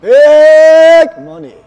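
long drawn-out high, loud call; hyped-up announcer holler, very slow; game show shout. Pretend. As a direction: belt it out like a game show catchphrase, stretched right out.

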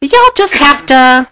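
high, slow ditzy voice; airhead operator. Retold or imitated. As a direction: play a dim switchboard girl.

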